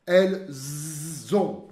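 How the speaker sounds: Nous avons with a z liaison, very slow. Shown as a demonstration. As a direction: link nous avons with a buzzing z. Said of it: "Som de Z também". (ligação com som de Z em nous avons, bem devagar)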